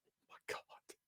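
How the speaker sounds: stunned breathless whisper; hushed disbelief. Pretend. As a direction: whisper it in awe. Awed reaction to a promo.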